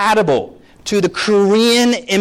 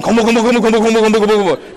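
mock nagging parent; 5 semitones higher, 4 dB louder, much faster than usual. Immigrant parent nagging at home.

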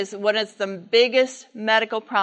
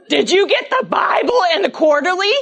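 exasperated wife; 7 semitones higher, 7 dB louder than usual. Retold or imitated. Mother scolds father.

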